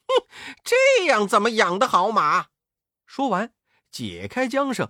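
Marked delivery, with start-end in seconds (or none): 0.1-2.5 哼一声，嫌弃地提高嗓门; 满不在乎地数落这些马; 不屑摇头. A narrator's reading. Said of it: "美猴王连连摇头说". (猴王看不上御马监，高声不屑)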